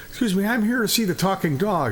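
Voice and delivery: lightly acted polite inquiry; curious visitor. Visitor asks owner for talking dog, lightly voiced curiosity. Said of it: "There's a guy standing in front".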